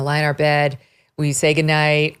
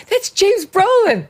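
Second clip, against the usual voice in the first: mock exasperated reveal; 4 dB louder, slower than usual. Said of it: "I'm like".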